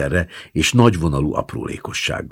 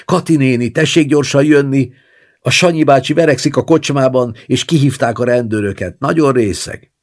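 higher, louder calling from the yard; excited shouting woman; 11 semitones higher, 7 dB louder than usual. A narrator's reading. Neighbour woman yells to grandma from the yard, excited call. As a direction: shout the news urgently through the window. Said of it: "egy izgatott női hang bekiabált az udvarról".

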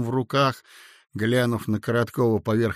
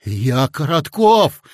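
louder, slow, exasperated; impatient shout; 3 semitones higher, 8 dB louder, slower than usual. A narrator's reading. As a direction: snap out your own name. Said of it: "нетерпеливо крикнул Коротков". (нетерпеливый крик)

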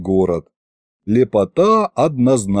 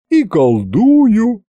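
put-on old witch voice; 9 semitones higher, much slower than usual. Old witch Yaguta answers.

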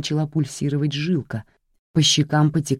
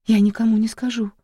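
отчаянная мольба; 7 semitones higher than usual. Acted. Жертва умоляет.

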